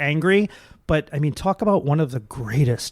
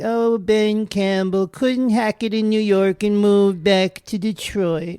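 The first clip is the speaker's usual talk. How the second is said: slow, high, drawling Capote voice; Truman Capote impression; 5 semitones higher, 3 dB louder, slower than usual. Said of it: "I do a really good impression of him".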